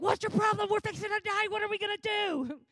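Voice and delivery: high, loud mock panic; freaking out. Terrified boy yelling at cool-headed Elisha, freaking out. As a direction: yell it in hammed-up hysterics. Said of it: "the little boy freaks out".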